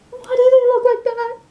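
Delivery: very high, loud squeal of alarm; mock frightened. Viewer reacting to the ghost children, mock frightened squeal. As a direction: squeal it, comically creeped out.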